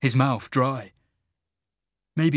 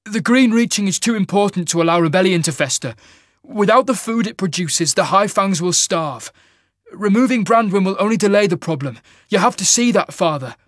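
high, fast, urgent pleading; young king's loud, desperate appeal; desperate edge; 8 semitones higher, 6 dB louder than usual. Acted. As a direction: rush the argument to make him listen. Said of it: "a desperate edge to his voice".

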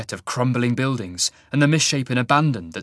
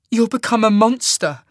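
high, raised voice near tears; holding back tears; 11 semitones higher, 6 dB louder than usual. A narrator's reading. Sasha to her changing friend, holding back tears. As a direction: protest while fighting back tears. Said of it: "trying to hold back tears".

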